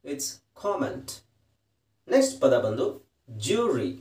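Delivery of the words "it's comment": It's comment said very slowly and softly; pron. Shown as a demonstration. Teacher answers her own question, very slowly: comment. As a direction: comment stretched out quietly, KOM-ment. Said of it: "ಇದನ್ನ ಹೇಗೆ ಹೇಳ್ತೀರಾ".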